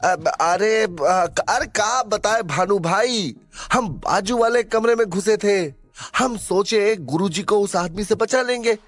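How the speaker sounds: high, stammering Bihari sidekick; stammer, then explain away in a high rustic voice; flustered excuse. Acted. Nervous sidekick making excuses to Bhanu, flustered. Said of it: "समझ नहीं आ रहा था कि क्या जवाब दे".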